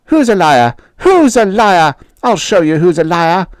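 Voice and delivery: loud, high menacing repeat; bully threatening. Acted. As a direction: push the challenge harder.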